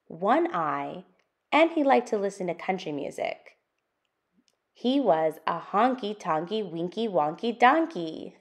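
lively, sing-song picture book reading; reading to children. Acted. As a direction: read it out for little listeners, building the list. Reads the picture book aloud.

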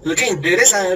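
usual speaking voice

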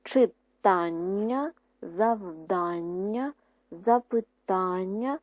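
Читання, завдання, запитання drawn out slowly, stress on the last-but-one syllable; slow syllable-by-syllable reading. Shown as a demonstration. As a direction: stretch each word slowly, leaning on -тання and -дання. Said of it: "Нам потрібно ... поставити наголос". (повільно, по складах, з наголосом)

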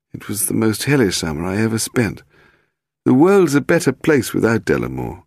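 high-pitched, agitated little man; aggrieved Willie. Acted. Suspect explaining the old affair, high aggrieved voice. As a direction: pour out the grievance in a high voice.

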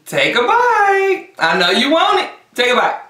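sing-song coaxing. Acted. Coaxing herself to eat.

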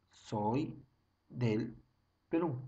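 "Soy", "del", "Perú" with pauses between; word by word. Shown as a demonstration. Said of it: "Otra vez". ("Soy... del... Perú" separado con pausas)

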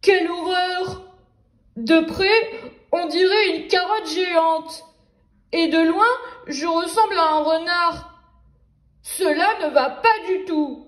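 the wolf appalled to look like a carrot; wolf exclaiming. Acted. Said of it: "et s'exclama".

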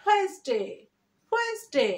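high, falling "WENS-day"; smart pronunciation. Shown as a demonstration. Teacher modelling when plus s, two-syllable smart pronunciation. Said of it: "উচ্চারণ করছি".

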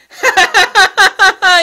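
loud, high burst of laughter. Real, not acted. Vlogger laughing at his overlong video, loud high laugh. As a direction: cackle loudly at your own remark.

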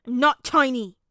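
loud, indignant pouty protest; pouting. A narrator's reading. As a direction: protest like an offended small child. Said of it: "he pouted".

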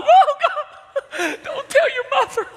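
high, laughing, begging voice; frantic pleading bribe. Retold or imitated. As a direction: plead with the kid not to tell. Bribing found child, laughing.

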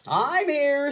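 high, light bird voice; high flamingo voice. A narrator's reading. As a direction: chirp in as a flamingo.

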